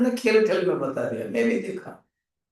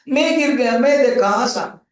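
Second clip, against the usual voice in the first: louder, higher, punching each मैं; emphatic teaching; 8 semitones higher, 8 dB louder than usual. Real, not acted. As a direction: drive home "मैं गिर गया" as a lesson. Stressing the three roles.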